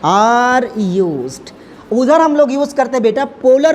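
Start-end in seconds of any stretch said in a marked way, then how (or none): 0.0-1.5 very slow, stretched-out words; drawn-out board-writing voice, dropping at the end; dictating while writing. Real, not acted. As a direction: dictate word by word, pausing as you write. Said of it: "तो यहाँ पर लिखेंगे".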